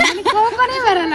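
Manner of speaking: high-pitched laughing claim. Real, not acted. Co-star claims she never gets angry, laughing.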